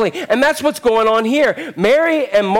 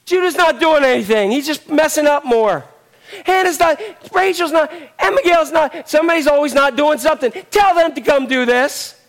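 higher-pitched mock tattling child; complaining kids; 6 semitones higher than usual. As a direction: imitate kids protesting about their siblings. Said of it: "I've told my kids to clean the room".

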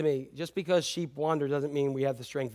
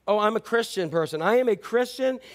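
mock self-righteous; 6 semitones higher, 3 dB louder than usual. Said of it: "mocking the hypocrite".

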